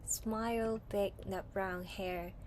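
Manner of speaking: unhurried storybook read-aloud, gently voiced; reading to children. Teacher reading a picture book to pupils, soft storytelling. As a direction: read it like a bedtime story to a class.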